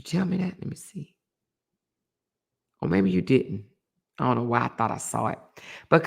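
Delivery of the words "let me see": whispered to herself. Real, not acted. Muttering while checking texts.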